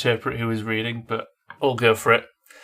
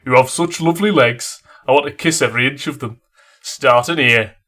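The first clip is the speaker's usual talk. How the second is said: hammed-up Yorkshire male lead, louder; a mock-seductive leading man; terrible Sean Bean; 6 dB louder than usual. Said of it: "terrible impression of Sean Bean".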